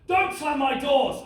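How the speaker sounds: high, explosive outburst with drawn-out words; enraged dad. Acted. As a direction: lose your temper, pitching it high. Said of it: "he lost his mind at me".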